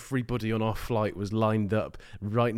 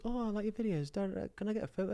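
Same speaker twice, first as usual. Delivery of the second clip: put-on girl fan voice; 8 semitones higher, 10 dB quieter than usual. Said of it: "girl comes up to me and goes".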